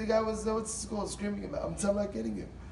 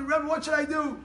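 pleading question; 8 semitones higher, 4 dB louder than usual. Retold or imitated. Husband pleads with the Rebbe.

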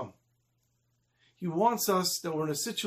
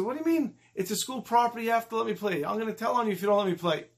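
higher-pitched schoolboy protest; acted childish outrage; indignant arguing; 5 semitones higher than usual. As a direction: insist like a kid threatening to tattle. Boy argues back, threatens to tell.

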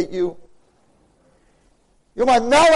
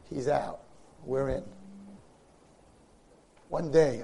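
usual speaking voice

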